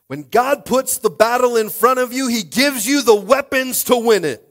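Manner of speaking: loud, high-pitched preacher's shout; full-force preaching. Real, not acted. Pastor telling the church God arms them for battle, shouted preaching. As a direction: belt it out to the whole congregation.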